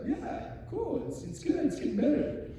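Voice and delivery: lightly voiced, half-hearted praise; polite friends. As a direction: humor the would-be singer. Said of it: "They're like".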